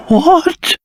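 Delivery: usual speaking voice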